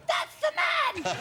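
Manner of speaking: very high, slow squeal; mock shrill accuser. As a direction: screech it like a startled accuser. Imitating a voice at the door.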